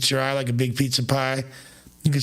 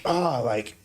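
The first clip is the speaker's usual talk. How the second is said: ordinary talk, nothing marked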